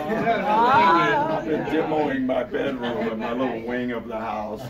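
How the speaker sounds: slow words through loud laughter; laughing while talking. Real, not acted.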